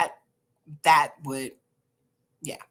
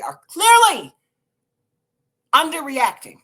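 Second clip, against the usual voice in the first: much higher and louder on clearly; emphatic stress; 8 semitones higher, 10 dB louder than usual. Real, not acted. Vlogger insisting the posters are underreacting. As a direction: punch clearly and underreacting hard.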